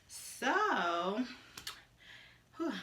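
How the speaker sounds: out of breath, puffing; winded, catching breath; heavy breathing. Real, not acted. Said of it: "I'm breathing hard".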